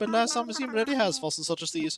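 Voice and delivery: lilting, high-pitched courteous scholar; fussy museum host. Acted. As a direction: appraise grandly as a fussy expert.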